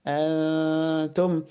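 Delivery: أنتم with a long hummed n; tongue suspended mid-mouth, humming before the ت of أنتم; ikhfa. Shown as a demonstration. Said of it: "يبقى اللسان في النص". (أنتم بغنّة الإخفاء)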